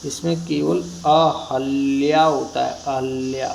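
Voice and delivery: अहल्या spelled out slowly with a plain ह, a-hal-ya; correct pronunciation. Shown as a demonstration. Teacher slowly showing students the right word अहल्या. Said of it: "ह आएगा केवल".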